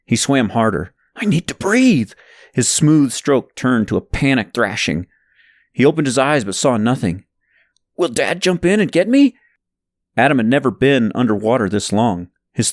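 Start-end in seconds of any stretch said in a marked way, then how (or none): 1.2-2.1 higher, strained panic, out of air; panicked. Acted. A boy running out of air, panicked and higher. 8.0-9.3 scared. Acted. Boy underwater, scared.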